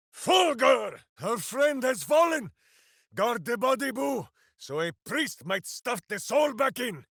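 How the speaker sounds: high, shouted battle cry; rally the hamster over the fallen friend. Acted. Ranger shouting to Boo to guard the fallen friend.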